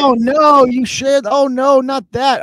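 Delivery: high, sarcastic fake dismay; exaggerated pretend outrage; mock horror. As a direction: play scandalized, laying it on thick. Feigns horror at critics' accusations.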